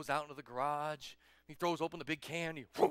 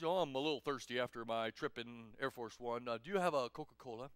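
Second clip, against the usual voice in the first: low, relaxed statesman voice; easygoing low-voiced visitor; President Obama; 6 semitones lower than usual. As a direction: play a casual presidential guest, pitched down. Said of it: "and he says".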